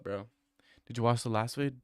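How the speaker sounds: normal talking voice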